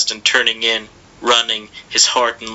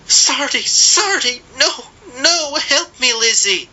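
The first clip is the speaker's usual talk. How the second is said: high desperate pleading cries; mother crying out. A narrator's reading. Mother calling her son and sister, crying high. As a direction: call after the fleeing boy, begging. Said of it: "his mother cried".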